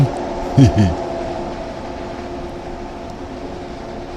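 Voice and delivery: a short breathy chuckle at his own joke; breathy laugh. Real, not acted. Commentator laughing at his own boast, breathy chuckle.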